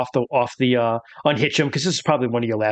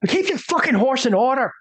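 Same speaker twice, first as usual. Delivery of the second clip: higher, snapped put-on woman's voice; angry woman; 9 semitones higher than usual. Game master repeating the woman scolding the rider. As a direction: spit it at the rider. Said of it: "she says".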